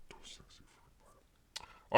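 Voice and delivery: breathy whispered aside. Acted. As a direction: whisper it teasingly.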